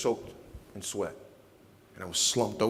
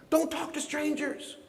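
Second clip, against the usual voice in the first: high, slow, sing-song jab; mock childish retort; slower than usual. Husband joking what he wanted to say to his wife, sing-song. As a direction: tease her like warning a child. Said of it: "You know what I felt like saying".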